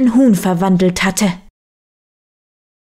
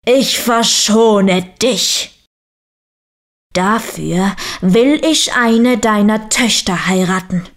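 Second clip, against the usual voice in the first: bear voice. A narrator's reading. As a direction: declare terms as a ponderous bear, slowing the opening words. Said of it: "sagte der Bär".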